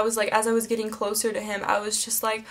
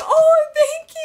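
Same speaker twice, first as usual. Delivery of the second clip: very high, loud fangirl squeal; acted-out starstruck reaction; overwhelmed gushing; 7 dB louder, slower than usual. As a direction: gush thanks in a high squeal. Acting out her overwhelmed first reaction.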